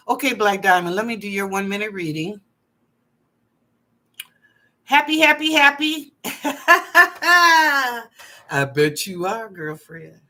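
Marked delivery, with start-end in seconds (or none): 4.8-8.1 higher, louder, sing-song happy, happy, happy; gushing excitement. Real, not acted. Psychic gushes to a caller about her happiness, excited. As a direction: bubble over with joy into a laugh.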